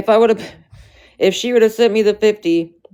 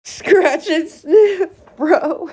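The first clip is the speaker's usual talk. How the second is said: high, slow laughing voice; drawn out through the giggles; 13 semitones higher, much slower than usual. Real, not acted. Cracking up at chat's joke, high and slow.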